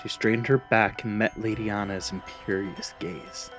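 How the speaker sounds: plain reading voice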